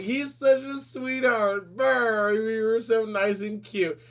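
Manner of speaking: high, slow cooing puppy talk; puppy mode. Retold or imitated. Doting on her co-host's sweet post in puppy mode. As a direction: gush slowly and high, like a puppy. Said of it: "I was in full puppy mode for that".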